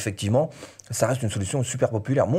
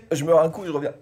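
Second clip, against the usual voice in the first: choking on a drink; 6 semitones higher, 3 dB louder, faster than usual. Real, not acted. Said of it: "je m'étouffe".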